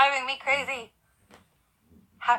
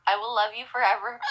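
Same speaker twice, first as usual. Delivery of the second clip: ordinary talk, nothing marked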